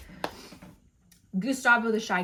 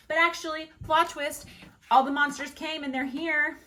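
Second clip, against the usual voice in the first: high, louder plot-twist announcement; spring the happy surprise, pitched up; excited reveal; 8 semitones higher, 5 dB louder than usual. Real, not acted. Storyteller revealing the monsters came after all, excited reveal.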